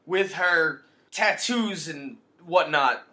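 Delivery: slow, loud, hammed-up disgust; drawn-out mock indignation. Cohost mocks the duo's complaint about tattoos, slow mock indignation. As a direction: stretch every word like a scandalised gossip.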